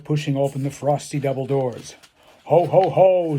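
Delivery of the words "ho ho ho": high, loud jolly shout. Acted. Santa greeting the citizens of Santa Town, loud shout. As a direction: belt it out like a big jolly Santa. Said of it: "Santa shouted".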